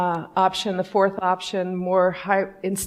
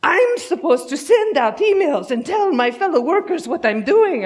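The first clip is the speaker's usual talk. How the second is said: high, loud mock outrage; hammed-up Russian indignation, pitch climbing; indignant Russian engineer; 7 semitones higher, 6 dB louder than usual. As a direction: imitate an exasperated Russian, building to a shout. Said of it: "And he goes".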